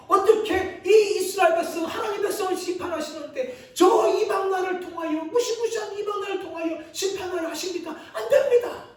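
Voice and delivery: high, loud, half-shouted protest peaking on the refusal; arguing with God. Acted. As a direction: plead against the judgment, climbing to a cry. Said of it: "그러자 하박국이 따집니다".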